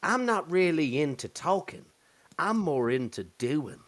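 quoting the girl in the video, light put-on voice; girl's voice. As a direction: play the girl, teasing. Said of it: "she goes".

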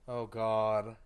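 ordinary talk, nothing marked

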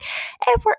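high-pitched half-yell, retold; kinda yelling. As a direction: call it out to be heard over loud party music. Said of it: "kinda yelling a little bit".

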